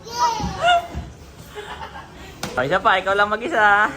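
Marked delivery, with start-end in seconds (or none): 0.1-0.8 high, drawn-out wows and yay; excited cheering. Real, not acted. Parent thrilled for child at the playground, excited cheering.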